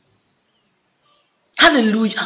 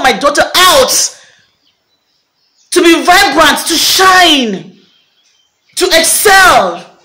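impassioned preacher's cadence, pitched up; fervent preaching; 5 semitones higher than usual. Real, not acted. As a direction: proclaim each phrase like a rousing prophecy. Prophetic exhortation, raised pitch.

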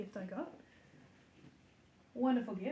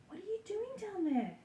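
much higher sing-song to the pup; 11 semitones higher than usual. Real, not acted. Owner coos at the puppy under the chair, high baby talk. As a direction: coo down at the puppy. Talking to puppy at her feet.

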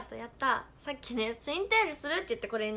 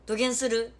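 loud, punchy Hakata dialect; forceful demo of heavy Hakata dialect; thick Hakata dialect; 7 dB louder than usual. Shown as a demonstration. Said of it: "どぎつい博多弁喋って".

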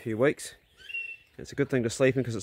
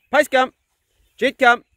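high, loud call across the paddock; calling a dog; 12 semitones higher, 9 dB louder than usual. Real, not acted. Owner calling his dog Poe back across the paddock, loud and high. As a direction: call her in from far off.

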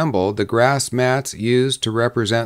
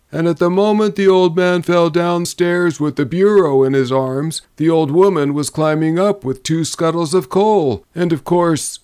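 higher, lively barroom raconteur; storytelling drummer; 7 semitones higher, 4 dB louder than usual. A narrator's reading. Drummer entertaining saloon companions with a tale, animated. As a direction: spin the tale in a raised voice.

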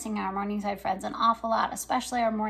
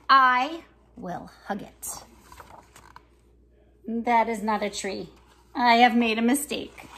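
lively picture-book reading with voices for each character; reading to children; 5 dB louder than usual. Acted. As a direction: read it like story time for little kids. Reads picture book aloud.

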